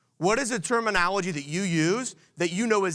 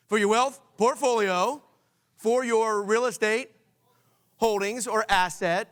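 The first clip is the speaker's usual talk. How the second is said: emphatic call-out; 4 semitones higher, slower than usual. Shown as a demonstration. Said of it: "just shout it out".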